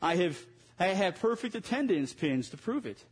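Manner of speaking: ordinary talk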